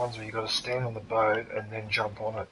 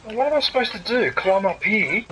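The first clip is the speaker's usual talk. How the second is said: loud, high, exasperated yelling; frustrated shouting; 10 semitones higher, 7 dB louder than usual. Real, not acted. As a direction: yell it out at the game, fed up. Gamer furious at missing number.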